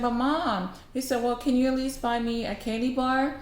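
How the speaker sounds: a hint of a child's plea; lightly voiced pleading boy. Boy asking his mom for candy, lightly pleading.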